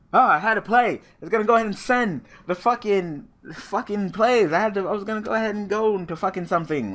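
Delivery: real gamer rage, voice up and loud; frustrated rant. Real, not acted. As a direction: vent at the screen in real frustration. Raging at a lost duel.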